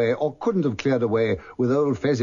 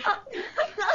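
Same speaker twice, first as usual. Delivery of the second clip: put-on high childish giggle; girlish laugh; 6 dB quieter than usual. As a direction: giggle like a little girl.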